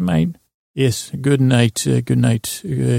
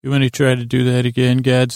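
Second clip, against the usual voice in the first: normal talking voice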